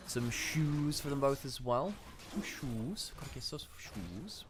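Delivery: put-on sing-song Welsh accent. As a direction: lilt it like a Welsh valley voice. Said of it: "Why are we suddenly going with the Welsh accent?".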